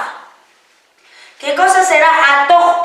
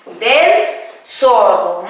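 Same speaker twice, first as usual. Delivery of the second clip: ordinary talk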